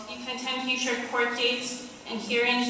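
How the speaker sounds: ordinary delivery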